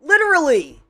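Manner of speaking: usual speaking voice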